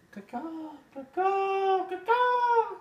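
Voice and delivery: very high, loud crow caw-caw; high piercing mock bird cry; bird call. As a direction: squawk like a crow. Imitated bird call segment signal.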